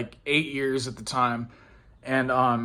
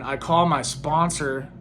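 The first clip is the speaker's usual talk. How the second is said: ordinary talk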